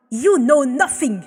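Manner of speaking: a loud, cutting snap; snapped. Acted. Abel snaps back at the pleading woman, loud. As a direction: shut her down hard. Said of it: "he snapped".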